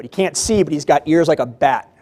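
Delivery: ordinary talk, nothing marked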